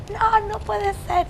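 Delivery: high, slow anguished wail; desperate disbelieving cry. Retold or imitated. Coordinator hearing the news by phone, anguished wail. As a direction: stretch it out, near a scream. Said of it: "empezó a gritar como loca".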